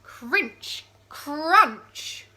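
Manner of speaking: loud, high crunch; crunchy sound word. A narrator's reading. Reading to children, crunching the noise aloud. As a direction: bite down on the crunch.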